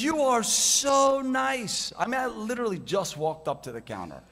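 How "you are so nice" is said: higher, slow, gushing lady's voice; gushing airline lady. Retold or imitated. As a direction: gush it out like a smitten clerk. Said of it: "And she says".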